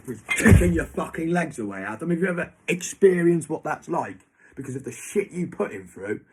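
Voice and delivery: loud, high, furious confrontation; angry outburst. Real, not acted. Confronting Adam about his father's kicks, furious shout. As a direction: hurl it at him, raging.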